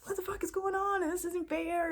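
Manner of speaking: high, whining self-pity; squeaky victim-mentality whine; whiny victim voice. Retold or imitated. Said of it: "I would feel like".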